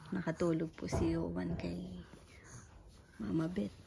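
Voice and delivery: unvoiced soft whisper. Real, not acted. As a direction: hush it to a whisper. Whispering near sleeping baby.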